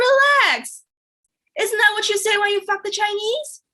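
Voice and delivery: high-pitched indignant comeback; acted retort, voice pushed way up; mock exasperation. Comedian answering her shocked date, mock exasperation.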